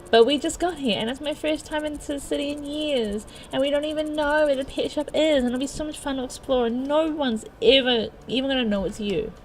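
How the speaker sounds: pleading protest. Acted. Girl begging her companion to explore, pleading. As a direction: plead like an eager child.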